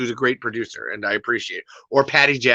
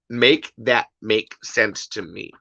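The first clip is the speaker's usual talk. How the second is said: spaced out word by word; drawn-out, emphatic demand; slow, deliberate plea; 3 semitones lower, 3 dB quieter, slower than usual. Real, not acted. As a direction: spell it out slowly, each word separate.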